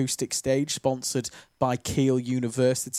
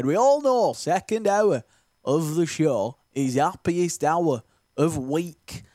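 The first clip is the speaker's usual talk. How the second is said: Ant and Dec impression; slower than usual. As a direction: ham it up like a Geordie TV host. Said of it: "that's my impression of Ant and Dec".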